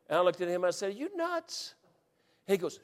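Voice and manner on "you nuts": high, soft incredulous squeak; hushed disbelief. Retold or imitated. Hunter questions his buddy's sanity, hushed disbelief. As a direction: keep it small and high, disbelieving.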